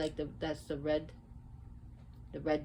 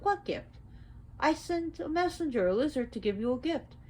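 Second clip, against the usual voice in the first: high indignant Oya; 10 semitones higher than usual. Acted. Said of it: "And Oya said".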